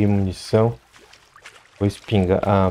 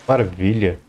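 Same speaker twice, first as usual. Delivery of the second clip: normal talking voice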